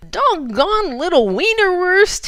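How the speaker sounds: high, loud and slow good-natured yell; amiable yell. Acted. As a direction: yell it with a grin. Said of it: "he yelled amiably".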